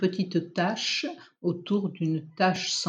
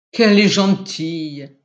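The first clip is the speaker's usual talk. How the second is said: louder delighted exclamation; husband exclaiming over his cat, louder; 8 dB louder than usual. A narrator's reading. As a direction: burst out fondly about the cat. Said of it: "s'exclama Alain".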